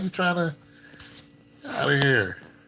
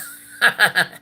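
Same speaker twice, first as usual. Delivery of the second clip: high, loud burst of laughter; amused laugh; 8 semitones higher, 7 dB louder than usual. Real, not acted. Chatting about taking a number down, laughing.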